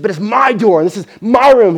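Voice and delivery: put-on high, loud protesting teenager; angry daughter. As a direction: shout it back like a furious girl. Daughter shouts back at father.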